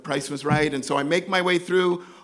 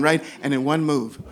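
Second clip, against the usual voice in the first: ordinary talk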